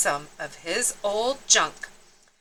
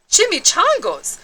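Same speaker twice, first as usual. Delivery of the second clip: growl; 10 semitones higher, 6 dB louder than usual. Acted. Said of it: "growled Don Diego".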